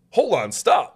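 loud, high retold protest; indignant retort. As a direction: cut in, imitating an indignant objection. Retold angry retort to accuser.

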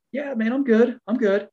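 high, lightly acted "don't worry about me"; retold brushing-off. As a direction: wave off concern in a high voice. Retold brushing off a hand injury.

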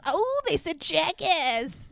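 lightly voiced giggly kid; mock kid voice. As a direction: tease like a snickering child.

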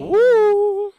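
high, loud woo; excited cheer. Real, not acted. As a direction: cheer like big news. Cheering the news.

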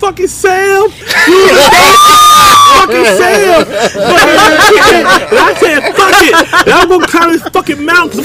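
high, loud hammed-up yelling; mock furious shout. Patient cursing back at doctor about Sam, hammed-up shout. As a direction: holler it in comic indignation.

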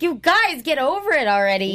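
high, loud exasperated outburst. Retold or imitated. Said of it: "I was like".

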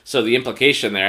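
ordinary talk, nothing marked

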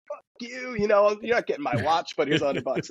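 ribbing a fan with a grin in the voice; Daly's teasing voice. Retold or imitated. Daly teasing the fan about the watch, high mock jab. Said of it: "he said it with these".

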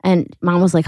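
ordinary delivery

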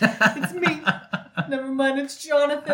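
high, laughing reply. Real, not acted. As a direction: laugh through the words.

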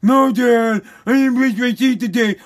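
mocking put-on voice of a kid; mimicked kid. Kid answering Dad about brushing teeth, mimicked mockingly.

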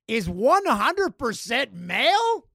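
loud, high, drawn-out incredulous question; mock disbelief. Host reads shocking listener stat aloud, mock disbelief.

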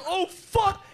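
high, alarmed retold curse; retold panic. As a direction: replay the moment of panic, pitch jumping way up. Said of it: "I'm like".

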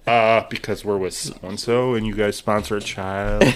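low, slow, unruffled caller's explanation; imitated caller. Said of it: "It was like".

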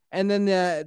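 ordinary talk, nothing marked

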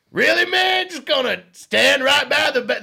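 high shouted jeer, slowed; loud heckle. Retold or imitated. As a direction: call out the creep across the room. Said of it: "And Dave goes".

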